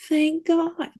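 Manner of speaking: high-pitched, heartfelt relief; relieved exclamation. Real, not acted. Relief at dogfighting ban.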